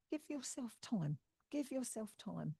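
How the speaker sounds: soft, unhurried, soothing repetition; gentle reassurance. Retold or imitated. Medium passes on a spirit lady's comfort, gently reassuring.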